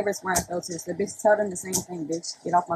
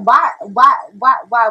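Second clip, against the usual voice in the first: loud, repeated, insistent questioning; mock-outraged demand; hammed-up demanding; 2 semitones higher, 8 dB louder than usual. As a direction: pound out each WHY loudly.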